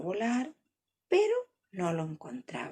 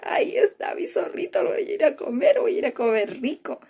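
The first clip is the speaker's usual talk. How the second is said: excited greedy call; 4 semitones higher, 3 dB louder than usual. Acted. Condor spots its meal.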